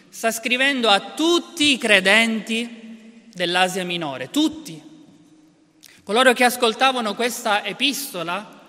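ordinary talk, nothing marked

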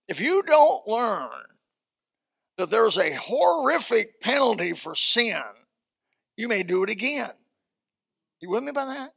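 rising preacher's fervor; preaching. Acted. Preacher pressing the congregation, fervent. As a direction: press the point home to the congregation.

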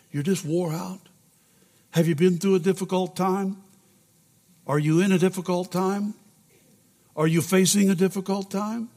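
usual speaking voice

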